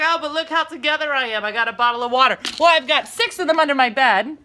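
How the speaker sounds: a light mock of her smug teen daughter, loud. Mom mimicking her daughter's excuse about water bottles, mild mocking voice. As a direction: parrot the kid's self-satisfied tone. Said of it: "She's like".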